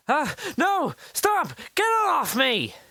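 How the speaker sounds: put-on boy calling out in alarm; panicked cry. A narrator's reading. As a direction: cry out as the thing grips your wrist. Boy grabbed by strange watch.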